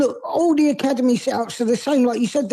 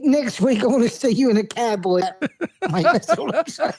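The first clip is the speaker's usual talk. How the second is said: laughing tease. Real, not acted. Teasing host, laughing.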